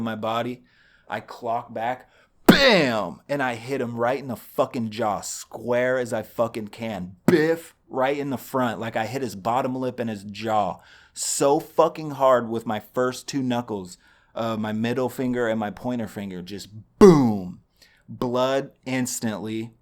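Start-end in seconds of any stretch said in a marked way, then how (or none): 2.5-3.2 loud, high "Bam!" to mark the hit; punch sound effect. Real, not acted. 7.3-7.8 sharp, high, loud "Biff"; punch sound effect. Real, not acted. 16.4-17.6 loud punched "Just boom"; boom sound effect. Real, not acted.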